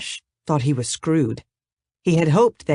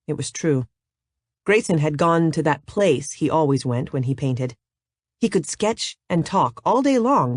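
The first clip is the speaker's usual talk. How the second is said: ordinary delivery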